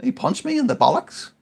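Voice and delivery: rising to a high, loud indignant cry; indignant outburst. Retold or imitated. A dad re-enacting his outrage at his son. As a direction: protest that it was no accident. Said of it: "angry retort".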